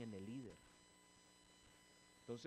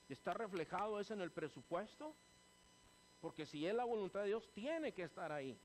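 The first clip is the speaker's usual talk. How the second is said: retold loud challenge; 7 semitones higher, 7 dB louder than usual. Pastor retells confronting church leader.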